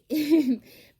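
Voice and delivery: sudden loud burst of laughter; real laugh breaking out loudly. Real, not acted. Reader overcome by strange energy mid-reading, laughing. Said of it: "I'm laughing".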